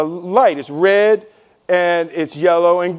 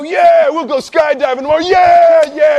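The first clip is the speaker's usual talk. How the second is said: high, loud whooping agreement; hammed-up party cheer at the bar; drunk excited shout; 8 semitones higher, 6 dB louder than usual. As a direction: yell it back like a hyped-up drunk friend.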